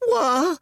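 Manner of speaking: high startled screech of Wah; the village boy jumps back screeching Wah; boy's screech. Acted. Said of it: "screeched the boy".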